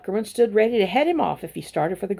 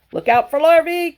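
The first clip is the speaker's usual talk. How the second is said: much higher, loud warning call; cows calling out; 9 semitones higher, 7 dB louder than usual. A narrator's reading. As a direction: call a warning across the barnyard. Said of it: "called the cows".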